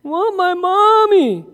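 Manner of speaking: high, slow whine of Want my mommy; whiny toddler. Shown as a demonstration. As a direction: pout it like a sleepy little girl. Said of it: "She didn't say".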